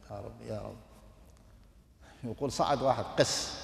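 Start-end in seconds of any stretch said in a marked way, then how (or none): 0.1-0.8 very soft, lower pleading prayer; hushed prayer. Retold or imitated. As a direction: whisper the call to God. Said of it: "هو يدعو هكذا". (دعاء خافت جدًا بصوت منخفض)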